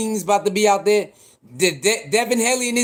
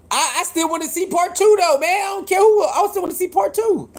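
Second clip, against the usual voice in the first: excited plea; 9 semitones higher than usual. Real, not acted. To his viewers, pleading for the rematch, high and eager. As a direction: beg the stream for a rematch, voice up high.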